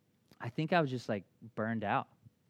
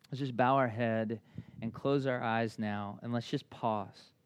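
prayer leading; 8 dB louder than usual. Acted. Pastor leading his congregation in prayer, steady and loud over music. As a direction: guide the room into pausing.

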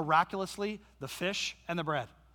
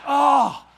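battle grunt; 7 semitones higher, 13 dB louder than usual. Retold or imitated. Dad ready to destroy snake.

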